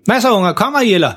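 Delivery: højere leje, behersket tilråb; råber til ungerne. Acted. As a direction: kald muntert på ungerne. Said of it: "så råber han tilbage til dem". (behersket tilråb)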